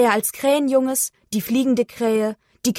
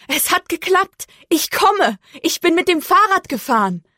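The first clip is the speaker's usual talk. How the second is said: high-pitched happy announcement; excited calling; 7 semitones higher, 4 dB louder than usual. A narrator's reading. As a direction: call it out high, proud of making it. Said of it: "rief sie". (aufgeregt und hoch gerufen)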